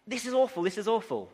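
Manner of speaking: anguished cry over the fleas. Retold or imitated. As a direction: let it burst out in despair, then fall back. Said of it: "And she cries out".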